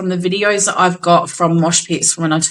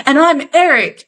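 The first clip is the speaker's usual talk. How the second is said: showman's flourish; 7 semitones higher, 4 dB louder than usual. Retold or imitated. Singer introduces himself last.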